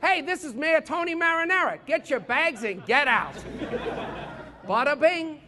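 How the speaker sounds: mock New Yorker mayor; brash New York welcome, hammed-up; New York accent. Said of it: "just get a New Yorker and be like".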